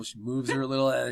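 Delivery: usual speaking voice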